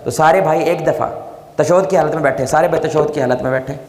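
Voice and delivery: ordinary delivery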